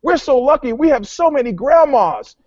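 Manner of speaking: put-on high, gleeful kid voice; lightly voiced happy grandchildren; imitating grandkids. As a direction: chirp it like a delighted child. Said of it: "his grandkids go".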